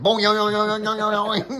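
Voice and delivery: high laughing boing yoing noises; boing sound effect. Retold or imitated. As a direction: mimic the arrow flopping with a springy sound. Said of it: "and then it, like".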